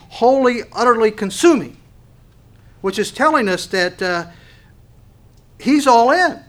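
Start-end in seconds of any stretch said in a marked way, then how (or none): 0.1-1.8 louder, higher preacher's push on wholly and utterly; rising sermon fervor; preaching emphasis. Real, not acted. 5.6-6.4 high, loud preacher's cry; full-force sermon climax; preaching shout. Real, not acted.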